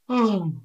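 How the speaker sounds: high, falling demonstrative sigh; weary sigh. Shown as a demonstration. Teacher miming tired for English learners, weary sigh. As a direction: sigh as if worn out.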